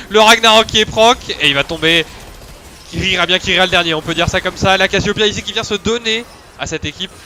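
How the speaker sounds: loud, high shouted play-by-play; esports caster. Acted. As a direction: scream the teamfight like a caster. (commentateur esport qui s'emballe)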